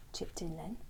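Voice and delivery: usual speaking voice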